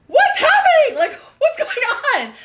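high, loud panicked questions, half laughing; hammed-up shriek of confusion; alarmed confusion. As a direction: yell it in startled bewilderment. Retelling her alarm at groping.

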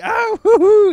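high, loud falsetto hoots; mock squealing hoots. Imitating the contestant's loud, high hoots. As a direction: hoot it out like a shrieking show-off. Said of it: "and then he's like".

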